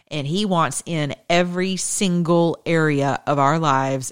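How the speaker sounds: ordinary talk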